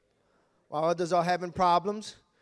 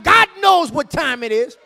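preaching shout; 9 semitones higher, 12 dB louder than usual. Acted. Pastor's shouted reply.

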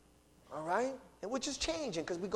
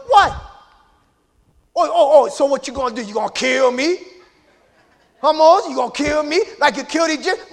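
loud, high street confrontation, taunting; angry shouting; 8 semitones higher, 16 dB louder than usual. Acted. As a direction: get in his face and yell. Acted street quarrel, Hebrew taunting.

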